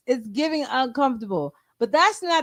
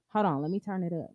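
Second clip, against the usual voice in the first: ordinary delivery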